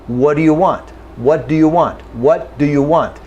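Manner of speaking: "What do you want?" with the T and D merged into one sound; natural linked speech. Shown as a demonstration. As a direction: run "what" into "do" without a break. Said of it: "No, I go".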